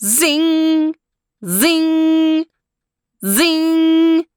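high zing sound effect; sharp mechanical zing; machine zing. Acted. Disc machine firing.

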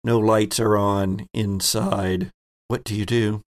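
ordinary talk, nothing marked

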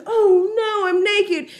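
high-pitched mock dismay; put-on high voice of alarm; panicked girl. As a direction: imitate a girl caught out. Voicing panicked girl caught in bed.

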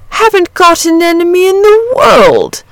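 high, ringing cheerful declaration. A narrator's reading. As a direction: sing it out blithely, pitch held high. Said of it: "said the dragon cheerfully".

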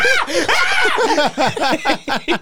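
big high wailing laugh. Retold or imitated. Sobbing laugh after song.

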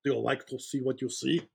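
put-on light seductive Russian accent; Russian accent, coaxing stripper. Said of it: "with a Russian accent".

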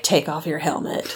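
low, mock-commanding armorer; forceful imitation. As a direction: quote a stern order, about to laugh. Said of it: "Sounds so forceful".

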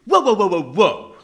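loud repeated whoa to stop him; loud alarm. Real, not acted. Agent stopping the writer in loud alarm.

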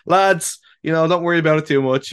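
high, loud breezy pep talk; mock coach team talk. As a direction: play a laid-back coach reassuring the lads. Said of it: "I can just picture you as a coach at halftime team talk".

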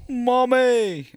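a high, loud hammed-up wail; mock scream. Host copies the guest's meditation scream, high mock wail. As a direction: shriek it like a crying child.

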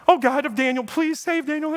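high, anxious plea; pleading prayer. Retold or imitated. The sleepless king begs Daniel's God, high and anxious. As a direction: beg for Daniel's life.

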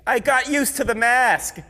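high, loud, slow sheepish excuse. Retold or imitated. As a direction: plead it sheepishly. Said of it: "I'll be like".

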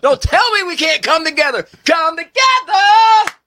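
high, loud rallying cry; preachy shout. Pretend. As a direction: belt it like a revival preacher. Mock rally cry, preacher style.

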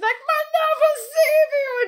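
very high, laughing mock heroine cry; over-the-top melodrama; hammed-up squeal. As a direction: squeal it like a cheesy romance lead. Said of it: "she's like".

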